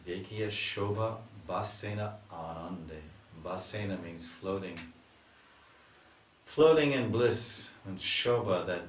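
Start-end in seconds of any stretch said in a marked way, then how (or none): 0.1-3.0 slow chanted verse line; verse recitation. Acted. Teacher repeating the verse line, slow and chanted. 6.4-7.7 loud, slow, soaring; ecstatic. Acted. Preacher describing the Lord's ecstasy, loud and slow.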